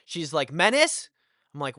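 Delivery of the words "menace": raised, high mock mom call, rising; calling from upstairs. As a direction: imitate a mom shouting for her kid. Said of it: "my mom calls from upstairs".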